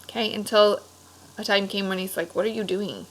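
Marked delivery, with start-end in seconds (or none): none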